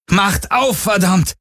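high, furious demand; angry demand. Acted. Galen demands they open the door, furious. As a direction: pound out the demand.

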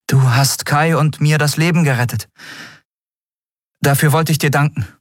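sincere thanks, a little embarrassed; captain Endriel. A narrator's reading. (gehobene Frauenstimme, verlegen dankend)

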